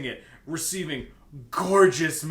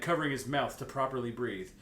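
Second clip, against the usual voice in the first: ordinary talk, nothing marked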